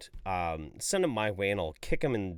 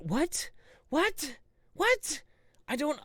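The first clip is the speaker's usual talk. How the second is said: each what pitched higher in disbelief; rising disbelief; much slower than usual. Real, not acted. Baffled at the clip, rising incredulous whats. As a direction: climb in disbelief. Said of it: "mounting disbelief".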